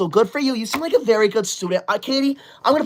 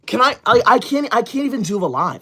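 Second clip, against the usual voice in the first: ordinary talk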